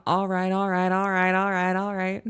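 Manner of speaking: sing-song [name] all right; [name] voice. Retold or imitated. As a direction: riff it like the [name] hook. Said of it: "I think of "[name]".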